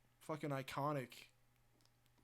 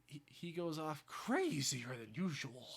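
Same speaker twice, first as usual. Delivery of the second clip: Australian Joker; slower than usual. Acted. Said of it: "Australian accent".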